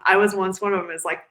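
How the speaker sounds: ordinary delivery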